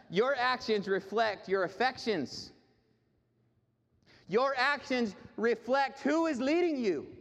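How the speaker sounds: high, slow, weighty sermon delivery; emphatic preaching. Real, not acted. Preacher telling the congregation what actions reflect, emphatic preaching. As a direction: hammer each word home.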